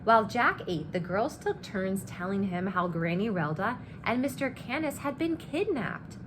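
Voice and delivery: ordinary delivery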